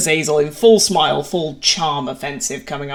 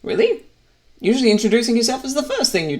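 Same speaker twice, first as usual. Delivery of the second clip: incredulous really; 7 semitones higher, faster than usual. Acted. Incredulous at not asking name.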